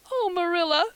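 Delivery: high, loud acted sob; sobbing girl. A narrator's reading. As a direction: wail the name as a weeping girl. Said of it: "sobbed Anne".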